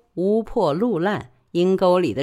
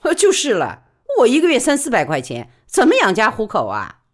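higher, louder, quick working woman; brisk high-pitched working woman pleading her case; Li Baoli's voice; 5 semitones higher, 5 dB louder, faster than usual. Acted. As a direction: press her case fast and eager. Li Baoli answers.

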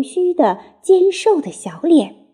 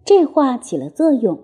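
plain reading voice

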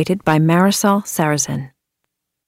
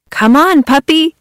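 higher, louder call; excited kids calling; 7 semitones higher, 3 dB louder than usual. Acted. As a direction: call the puppy, eager. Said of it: "said the kids".

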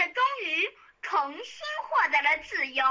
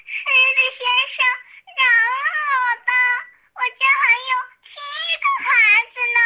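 high, louder begging mouse voice; pleading mouse; 8 semitones higher, 5 dB louder than usual. A narrator's reading. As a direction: beg the lion for mercy. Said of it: "老鼠哭着乞求说".

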